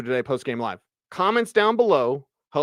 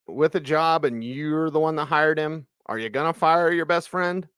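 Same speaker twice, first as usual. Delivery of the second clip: normal talking voice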